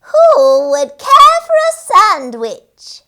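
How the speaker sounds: high, loud, slow call; sing-song creature call, nearer. A narrator's reading. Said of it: "closer and louder this time".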